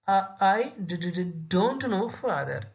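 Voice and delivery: halting, repeated d sounds in fear; a frightened girl stuttering before her father. Shown as a demonstration. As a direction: stammer out the words like a scared child. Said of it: "she used to say".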